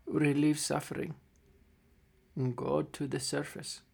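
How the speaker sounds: a lightly voiced old gnome, soft and fading, with coughs; dying old gnome. Dying gnome father says farewell to his construct, soft and failing. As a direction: give weak last words, trailing away.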